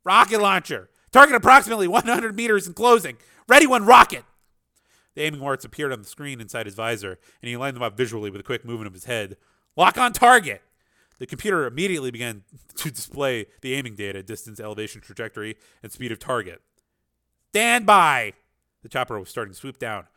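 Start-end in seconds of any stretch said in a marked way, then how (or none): 0.1-4.2 loud, high barked orders into a mic; shouted commands. A narrator's reading. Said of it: "He spoke into his helmet mic". 9.8-10.6 loud, high barked order; shouted command. A narrator's reading. Voice commands to weapon computer. 17.5-18.3 loud, high urgent order; shouted command. A narrator's reading. Voice commands to weapon computer.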